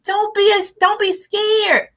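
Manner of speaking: normal talking voice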